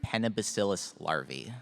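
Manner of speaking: slow down "Panabacillus" so the moderator catches it. Real, not acted. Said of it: "could you restate that a little more slowly".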